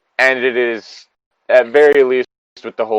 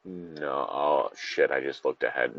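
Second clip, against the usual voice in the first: much softer, lower dismayed mutter; real quiet dismay; 8 semitones lower, 11 dB quieter, slower than usual. Real, not acted. Reader groans softly after spotting what comes next. Reacting while reading ahead.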